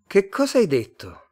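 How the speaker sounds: high, loud demand to repeat; loud uncomprehending query; baffled question. A narrator's reading. Both fail to understand each other.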